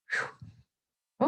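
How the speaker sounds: unvoiced, quiet impressed whew; a breathy blown-out whew of amazement; airy whistle of awe; soft whew. Real, not acted. Impressed by fast beetles.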